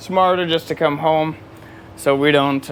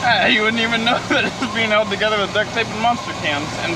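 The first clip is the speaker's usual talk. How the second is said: laughing; 8 semitones higher than usual. Real, not acted. Joking about the duct-tape repair.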